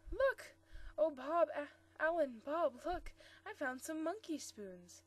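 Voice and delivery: bursting out eagerly, then easing slightly; eager call. A narrator's reading. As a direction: call your companions over to see a find. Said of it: "called out eagerly".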